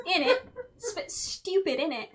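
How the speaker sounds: playful fake British accent; mock British. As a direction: ham up a London accent. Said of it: "cheeky Nando's".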